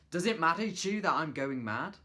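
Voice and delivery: loud and high, pushed to the extreme. Shown as a demonstration. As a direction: blast it out at full tilt. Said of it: "try it to an extreme".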